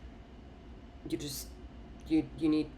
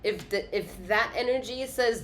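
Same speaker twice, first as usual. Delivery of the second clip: ordinary delivery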